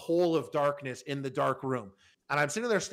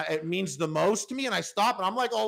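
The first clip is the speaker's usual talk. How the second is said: ordinary talk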